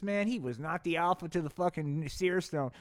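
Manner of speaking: usual speaking voice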